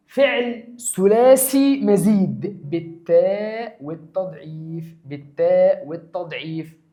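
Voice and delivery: very slow, stretched-out teaching delivery; slow dictation. Shown as a demonstration. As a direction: draw out each term for the students. Dictating the grammatical label.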